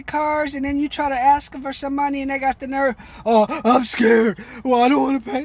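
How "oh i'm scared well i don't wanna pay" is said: mocking whiny customer. Mocking customers who won't pay.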